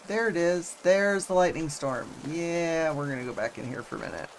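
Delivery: ordinary talk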